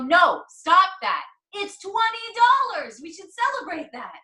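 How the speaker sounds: loud, high insistence on twenty dollars; animated, high-pitched protest at a downer; emphatic celebratory correction. Retold or imitated. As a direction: scold him into celebrating it. Acts out correcting partner's scarcity remark.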